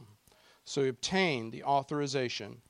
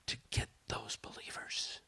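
whispering; 3 semitones lower, 10 dB quieter than usual. Acted.

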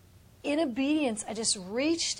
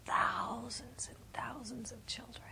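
hushed unvoiced awe; whispering in awe of the vision; awed whisper; 9 dB quieter than usual. Real, not acted. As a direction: breathe it in a whisper.

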